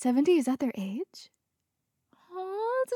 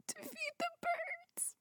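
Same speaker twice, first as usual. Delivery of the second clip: crying; 12 dB quieter than usual. Real, not acted. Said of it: "I just fucking cried over that".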